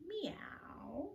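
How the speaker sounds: high whispered kitty meow; cat meow. Shown as a demonstration. Kitty cat stretch demo.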